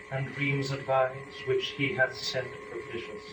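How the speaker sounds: ordinary delivery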